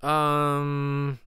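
hesitant, halting um; struggling for words. Acted. Nervous girl struggling to ask, halting. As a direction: hang on the word, unsure. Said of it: "struggles to get her words out".

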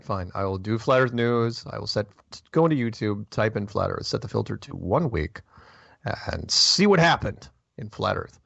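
normal talking voice

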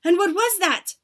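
high, loud eager question; cried out. A narrator's reading. Audience questioning their leader, cried out. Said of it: "cried the audience".

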